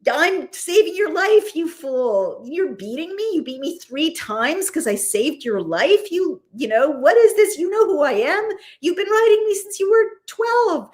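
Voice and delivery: high-pitched, outraged scolding of a grown charge; scolding nanny donkey. Acted. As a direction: play an exasperated donkey berating its rider. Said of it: "she's his nanny and he's grown up and she's scolding".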